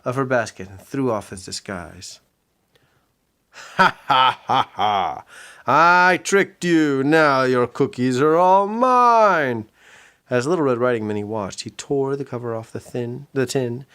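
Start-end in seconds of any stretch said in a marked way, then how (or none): 3.5-5.2 loud mock villain laugh. Pete gloating over tricked Red, loud mock laugh. 5.7-9.7 loud, slow, higher gloat; gloating shout. Acted. Pete gloating at Red, loud slow shout.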